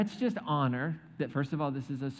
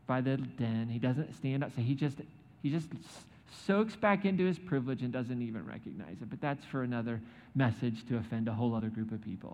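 normal talking voice

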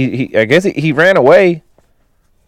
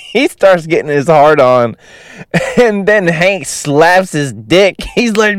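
giggling through the words, pitched up; can barely get it out for laughing; 6 semitones higher than usual. Real, not acted. Telling a friend the crude movie scene, laughing.

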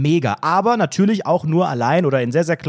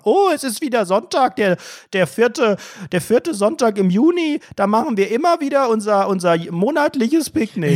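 higher, chirpy picnic fan; chirpy higher mock voice; 6 semitones higher than usual. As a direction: gush like a keen picnicker. Mocking regular picnickers.

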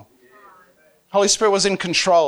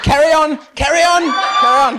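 loud, high call to keep going; comic loud go-ahead; hearty urging; 10 semitones higher, 8 dB louder than usual. Retold or imitated. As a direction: shout encouragement over the wailing. Recreates reply to crying girl.

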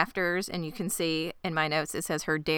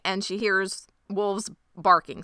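usual speaking voice

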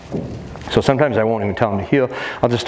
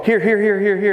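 higher eager calling, here here; calling the dog; 9 semitones higher than usual. Shown as a demonstration. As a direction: call the dog brightly. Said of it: "If you go".

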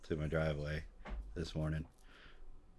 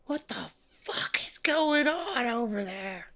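much higher, slow shrill-voiced yell of an angry neighbor; hammed-up angry woman shrieking through the trees; mock screaming woman. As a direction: imitate a furious woman shouting from far off. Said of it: "Just screaming through the woods".

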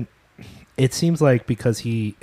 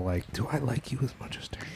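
hushed conspiratorial aside; whispering; 13 dB quieter, faster than usual. Real, not acted. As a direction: whisper it like a secret. Whispered aside about the host.

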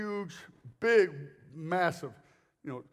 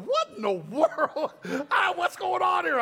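high, loud, stretched disbelief; loud astonishment; 11 semitones higher, 5 dB louder, slower than usual. Retold or imitated. Preacher baffled by the church erupting, loud amazement. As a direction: exclaim it high over the uproar.

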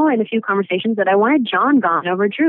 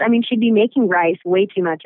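ordinary talk

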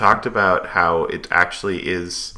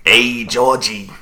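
slow, creepy Brooklyn clown; drawn-out creepy clown greeting; Pennywise voice; 4 semitones higher, much slower than usual. Retold or imitated. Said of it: "[name] nailed it".

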